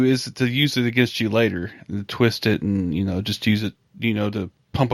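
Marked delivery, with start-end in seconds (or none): none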